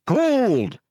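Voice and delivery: high, loud roar; roaring crowd. Acted. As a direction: roar back as one. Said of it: "they roared".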